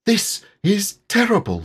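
Piglet voice. A narrator's reading. Piglet's panicked thought.

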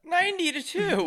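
high, loud disbelieving echo; incredulous. Real, not acted. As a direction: squeal it in disbelief. Disbelief at joke score.

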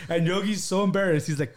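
ordinary delivery

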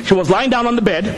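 ordinary delivery

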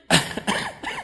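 short laugh breaking out; a real laugh at Jonah; high amused laugh. Real, not acted. The preacher laughs at Jonah's sulk, high and light.

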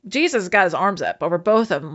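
ordinary talk, nothing marked

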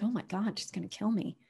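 quiet, nervous aside; scared murmur. Retold or imitated. Imagining meeting Lulu's mean eyes, quietly scared. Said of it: "And you're like".

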